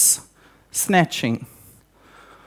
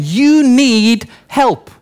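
very slow, stretched-out words; drawn-out pronouncement; 3 semitones higher, 3 dB louder, much slower than usual. Acted. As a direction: spell out a blunt verdict, slowly. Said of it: "the mirror is just saying".

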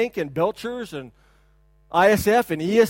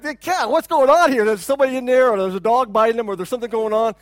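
raised, urgent mock panic; mock alarm; 3 semitones higher, 7 dB louder than usual. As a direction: play a startled parent reacting to screams. Acts out alarmed reaction to screaming.

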